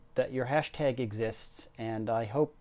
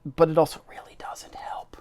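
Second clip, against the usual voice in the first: ordinary delivery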